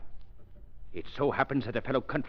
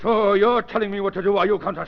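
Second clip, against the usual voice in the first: indignant retort; 9 semitones higher, 6 dB louder than usual. Acted. Villain bristles at the Countess.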